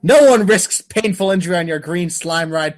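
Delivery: ordinary delivery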